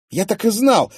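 high, loud excited outburst; a young man bursting out in triumph; excited exclamation. Acted. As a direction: blurt it out, sure he was right. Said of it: "воскликнул Клим".

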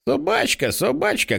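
put-on high child voice, rising on the repeat; little girl's cry. A narrator's reading. Small girl seeing the doggy, high-pitched childish cry. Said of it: "кричить Піпа".

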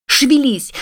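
high, hard shout. A narrator's reading. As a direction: hit hard crying out. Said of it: "кричала на него Кэт".

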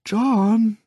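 high, drawn-out call; small, wheedling voice. A narrator's reading. Woman calling from the bathroom, small wheedling voice. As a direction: coax him timidly from afar. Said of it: "eine kleine, schmeichlerische Stimme".

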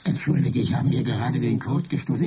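ordinary talk, nothing marked

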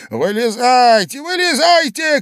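put-on old man shouting. A narrator's reading. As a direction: order them out at a yell. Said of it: "кричал старик". (гневный крик старика)

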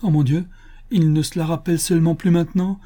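high-pitched, distressed lament; heartbroken. A narrator's reading. Mariette thinks Louis forgot his letter, heartbroken. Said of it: "avec un accent navrant".

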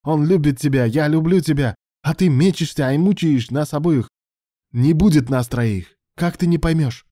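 loud, high-pitched reproach; angry exasperation. Acted. Alan makes his lover choose, loudly exasperated.

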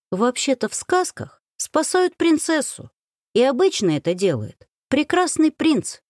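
high-pitched, know-it-all correction; pedantic correction. A narrator's reading. Wife correcting husband on fairy tales, pedantic. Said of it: "поправила педантично".

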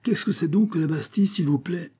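high-pitched, indignant question; angry challenge. A narrator's reading. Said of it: "un regard étincelant de colère". (question lancée avec colère)

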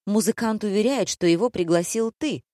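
ordinary delivery

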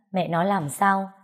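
ordinary delivery, nothing marked

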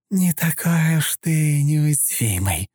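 high, slow hissing taunt. A narrator's reading. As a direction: hiss it with spite. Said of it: "прошипела женщина".

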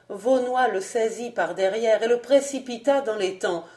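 ordinary delivery

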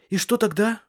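high, eager question; eager exclamation. A narrator's reading. Woman urging him to go on, high and excited. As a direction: exclaim it, pitched well up. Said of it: "воскликнула она".

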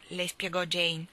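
plain reading voice

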